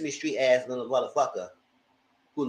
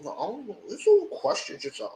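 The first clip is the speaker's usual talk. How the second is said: mock evasive; 10 semitones higher, 3 dB quieter than usual. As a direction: imitate someone dodging, higher and softer. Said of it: "Mia would be an evasive".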